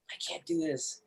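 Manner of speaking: whispered in defeat. Retold or imitated. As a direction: breathe it out, giving up. Retold breaking point, to himself.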